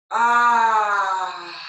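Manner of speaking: long loud crying-out ah sigh; dramatic sigh. Shown as a demonstration. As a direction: release everything in a big, loud, wailing sigh. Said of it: "let it be a big sigh out".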